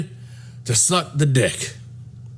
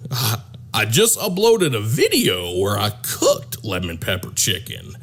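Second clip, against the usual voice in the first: a hint of a proud man; 6 semitones lower than usual. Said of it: "Doug was like".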